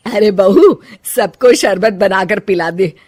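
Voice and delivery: loud, bossy elder woman calling out; bossy mother-in-law. Acted. Mother-in-law calling out to daughter-in-law for drinks, loud and bossy. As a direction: order the daughter-in-law around, fanning off the heat.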